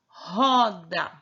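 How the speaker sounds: roda with a throaty initial r; word shown. Shown as a demonstration. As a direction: roda said firmly, opening on a breathy h-like r. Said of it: "é a palavra".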